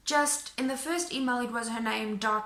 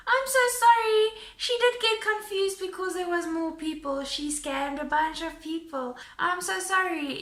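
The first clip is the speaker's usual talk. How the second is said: higher, louder gushing apology; apologetic police officer; 6 semitones higher, 6 dB louder than usual. Retold or imitated. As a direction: imitate the officer overdoing sympathy. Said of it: "they say to me".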